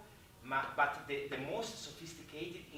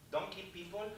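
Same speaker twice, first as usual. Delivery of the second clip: ordinary talk, nothing marked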